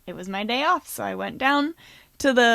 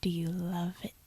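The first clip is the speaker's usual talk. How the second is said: soft whispered question; ASMR whisper; 3 semitones lower, 9 dB quieter than usual. Acted. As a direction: tease in an ASMR hush. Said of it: "ASMR voice continued".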